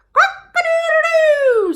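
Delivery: high, loud, drawn-out rooster crow; squawking cockerel. A narrator's reading. The cockerel after the medicine, loud squawking crow. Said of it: "squawked the cockerel".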